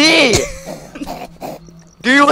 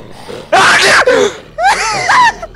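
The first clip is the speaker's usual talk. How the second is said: high shrieking laughter; screaming laugh; 14 semitones higher than usual. Real, not acted. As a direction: cackle at the chaos. Laughing at the chaos.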